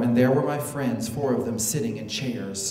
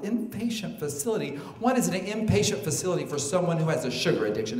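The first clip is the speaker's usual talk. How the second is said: higher, baffled echo; incredulous; 4 semitones higher, faster than usual. Retold or imitated. Guest questions rehab for sugar, incredulous. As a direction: throw the words back in disbelief, then rattle on.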